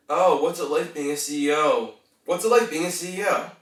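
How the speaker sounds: keenly curious question; interested. Shown as a demonstration. Coach showing how to ask about a job, interested. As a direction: lift the voice with interest. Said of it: "You wanna sound interested?".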